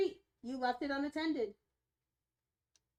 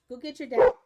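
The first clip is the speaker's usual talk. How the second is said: much louder order to the dog, rising at the end; loud command; 14 dB louder, faster than usual. Real, not acted. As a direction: call it out firmly.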